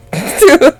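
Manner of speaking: high rising laugh; mad-as-hell laugh; exasperated laugh. Real, not acted. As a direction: laugh in disbelief. Laughing after saying she's mad.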